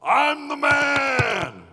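high, slow shouted boast; boastful Peter. Retold or imitated. Preacher voicing Peter bragging, a loud slow shout. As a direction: crow it out like a proud disciple.

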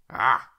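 loud, high animal grunt; baboon grunt. A narrator's reading. Baboon greeting the boy, loud grunt. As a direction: bark out an imitated ape's "ah". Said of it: "Khufu grunted at me".

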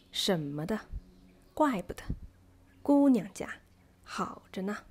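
strong first syllable with two light ones, as in 怪不得; stressed, light, light. Shown as a demonstration. Teacher gives spoken-language examples, first syllable stressed, rest light. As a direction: hit the first syllable of 姑娘家, tuck the other two in lightly. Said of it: "stressed with light and light".

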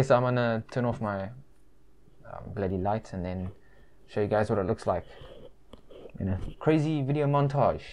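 muffled behind cloth mask; talking with covered mouth; through a face mask. Real, not acted. Seen 1: Printed cloth mask covers mouth and nose throughout; references show bare face.

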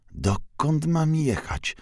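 lost and fretting at the fork; anxious question. A narrator's reading. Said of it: "Pocztuś gorączkowo szukał wskazówki".

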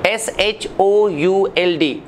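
एस एच ओ यू एल डी spelled out slowly; spelling demo. Shown as a demonstration. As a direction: spell should letter by letter. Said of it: "शुड". (धीमी spelling)

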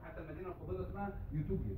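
ordinary talk, nothing marked

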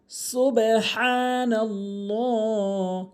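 slow, drawn-out melodic chanting; Quran recitation. Shown as a demonstration. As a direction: recite the verse in slow tajwid.

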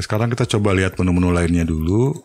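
ordinary talk, nothing marked

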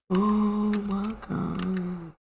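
high, slow, drawn-out wail; thin high moan climbing in pitch; frightened whine. Real, not acted. Frightened in game.

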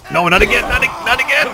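no not again, shouted; dismayed cry. Real, not acted. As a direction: wail in disbelief at the mistake. Killed an ally by accident.

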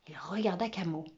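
ordinary delivery

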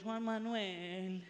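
slow, low motherly call; mother calling son. Acted. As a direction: draw out the name like a patient mom. Mother calling child, teaching.

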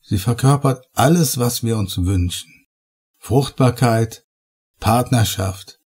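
lightly voiced enthusiast lost in adoration; rapturous. A narrator's reading. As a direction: savour each word like a treasure. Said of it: "Seine Stimme hatte etwas Schwärmerisches".

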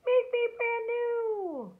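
very high, slow, squeaky voice; high melted snowman voice. A narrator's reading. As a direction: squeak it from the puddle. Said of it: "a voice from the tub said".

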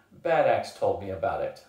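usual speaking voice

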